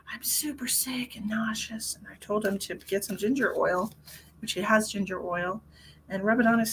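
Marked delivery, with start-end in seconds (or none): 0.1-2.0 whispered retelling. Retold or imitated. Whispering friend's message.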